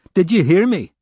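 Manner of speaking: high, loud agitated yell. Acted. Agitated O'Dell yelling at the lieutenant across the desk. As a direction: shout it at him, losing patience. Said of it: "he yelled".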